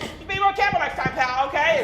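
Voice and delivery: mock tough guy. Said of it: "I was like".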